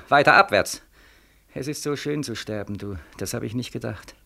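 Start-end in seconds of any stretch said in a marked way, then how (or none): 1.5-4.1 softer, drifting into death; soft fading. Acted. Dying Beckmann on the street, fading softly. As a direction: let it fade away as you slip off.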